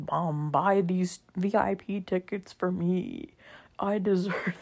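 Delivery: mock entitled teen, slow and whiny; spoiled brat imitation. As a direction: parody a spoiled kid begging mom. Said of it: "people think that I'm like".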